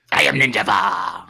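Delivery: slow mock ninja voice. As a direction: intone like a movie ninja. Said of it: "I love my ninja voice".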